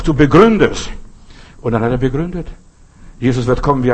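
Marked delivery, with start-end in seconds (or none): 0.0-0.9 louder, demanding call from the floor. Retold or imitated. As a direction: challenge him to explain, raised voice. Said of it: "rief ich noch".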